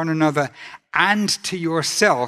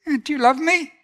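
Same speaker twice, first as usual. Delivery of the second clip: gentle question; 10 semitones higher, faster than usual. Retold or imitated. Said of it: "He just says".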